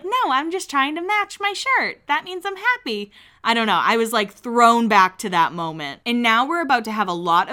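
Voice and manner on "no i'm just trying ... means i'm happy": put-on airy girl voice. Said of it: "she's like".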